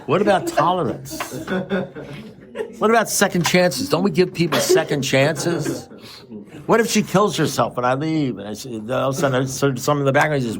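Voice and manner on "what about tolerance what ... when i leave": high-pitched imitation of a pleading friend; retold protest, pitched up. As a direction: plead the case in a raised, mock-earnest pitch.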